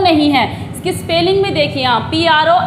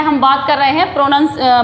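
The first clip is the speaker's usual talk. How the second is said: ordinary talk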